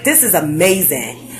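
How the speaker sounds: normal talking voice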